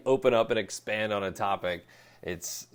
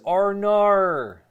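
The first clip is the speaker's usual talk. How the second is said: higher, louder mock Australian accent; bad Australian accent; 10 semitones higher, 11 dB louder than usual. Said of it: "All I know how to say with an Australian accent".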